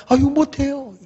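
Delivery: high put-on refusal; mock reluctance. Believer asked to go evangelize, mock reluctance. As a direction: beg off, imitating a timid believer.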